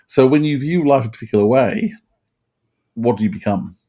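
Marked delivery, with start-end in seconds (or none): none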